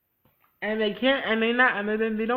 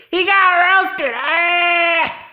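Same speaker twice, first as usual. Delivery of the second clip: loud, much higher triumphant cry; gleeful shout; 12 semitones higher, 12 dB louder, much slower than usual. Real, not acted. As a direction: crow it out with glee. Gleeful reveal of his death.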